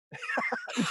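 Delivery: a quick, very high laugh. Real, not acted. Recounting the neighbor's joke, a short high laugh.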